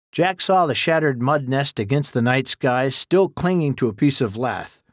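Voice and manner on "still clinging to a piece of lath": ordinary delivery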